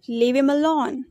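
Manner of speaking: "him" without its h, run into "leave"; weak form of HIM. Shown as a demonstration. Teacher showing learners weak forms, "him" said as 'im'. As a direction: link it as 'leavim alone'. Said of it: "We pronounced".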